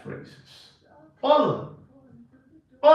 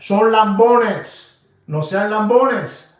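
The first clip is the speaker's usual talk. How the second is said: loud scolding; 5 semitones higher, 8 dB louder, slower than usual. Real, not acted. Scolding rival dealers.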